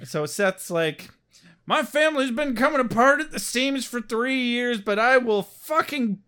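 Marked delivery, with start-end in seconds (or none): 1.7-6.2 high, hammed-up desperate vow; imitated frantic bargaining; mock desperation. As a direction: plead like a dad at the end of his rope. Dad desperate for Angel's help.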